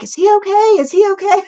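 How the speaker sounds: high, frantic retold worry; panicked worry. Watching her son's chute fail, frantic high worry. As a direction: relive the panic as she watches him fall. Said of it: "And then I'm like".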